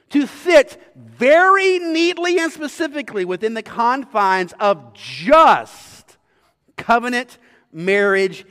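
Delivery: loud, high, emphatic sermon; forceful preaching. Acted. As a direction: drive the point home from the pulpit.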